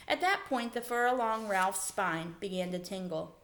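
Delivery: ordinary delivery, nothing marked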